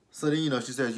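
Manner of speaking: ordinary talk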